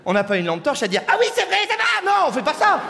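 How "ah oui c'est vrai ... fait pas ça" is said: high, bright mock delight; mock woman inspecting with a torch; mock girly voice. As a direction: squeak it out in exaggerated praise.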